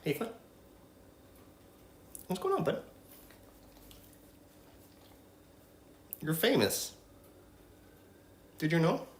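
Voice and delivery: talking to a dog. Real, not acted. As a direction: coo gently at a sweet pet. Cooing softly at her dog Flynn.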